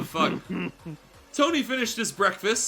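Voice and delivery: ordinary delivery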